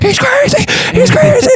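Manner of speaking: very high, loud squealing imitation; mock hysterical. Imitating the guy heard on speakerphone, mock hysterical. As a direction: shriek it like a panicked caller.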